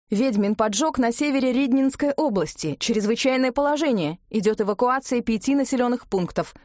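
urgent newsreader delivery; radio news bulletin. Acted. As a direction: read it out like an emergency broadcast. Radio emergency bulletin read out.

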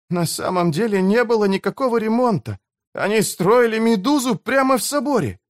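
put-on a shocked young man's realisation. Beavis realises the truth. (взволнованный юноша)

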